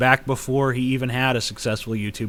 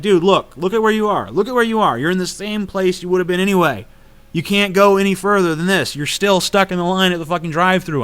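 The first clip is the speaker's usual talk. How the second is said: higher, louder arguing voice; retold exasperated protest; 7 semitones higher, 4 dB louder than usual. Arguing with the driver at the drive-thru, retold exasperation. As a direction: re-enact an indignant roadside argument. Acts out argument with angry driver.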